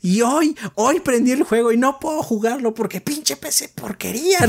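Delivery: high-pitched mock rant, slow at first; Greg Miller rant. Imitating Greg Miller cursing his PC on Twitter, high mock fury. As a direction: ham up a furious gamer's complaint about his broken PC. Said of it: "empezó a hacer un rant puerco".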